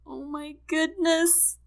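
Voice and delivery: high, slow, tearful wail; sobbing. A narrator's reading. Wife takes in her husband's stroke, sobbing. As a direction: moan it out as if sobbing. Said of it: "the woman sobs".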